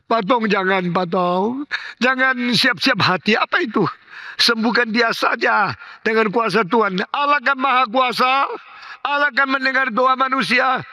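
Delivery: high, insistent pleading; desperate protest. Retold or imitated. Wife begging the pastor to pray for healing, desperate protest.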